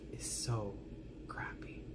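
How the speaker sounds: breathy whispered word. Real, not acted. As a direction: drop to a whisper on it. Confession, word dropped to whisper.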